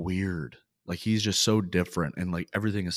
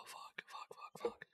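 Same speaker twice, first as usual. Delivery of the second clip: frustrated cursing, barely voiced; whispered cursing; 20 dB quieter, slower than usual. Real, not acted. Host whispering curses while losing his train of thought. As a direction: whisper fuck, fuck under your breath. Host fumbling before next pick.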